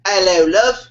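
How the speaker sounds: lightly done mock British accent; a smooth British greeting to the girl. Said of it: "You put an accent on".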